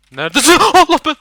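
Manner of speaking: very loud, high yelp into a laugh; startled shriek. Real, not acted. Gamer ambushed by monster, shrieking then laughing.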